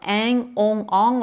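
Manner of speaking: southern French nasal vowels with a slight NG tail; southern French accent. Shown as a demonstration. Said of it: "avec mon accent du sud". (accent du sud de la France)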